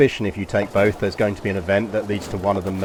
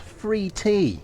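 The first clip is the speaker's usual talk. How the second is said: excited emphasis; 10 semitones higher than usual. Shown as a demonstration. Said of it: "will send you free tea, chat".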